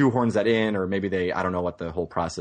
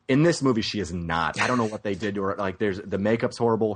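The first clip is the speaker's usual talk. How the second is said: ordinary talk, nothing marked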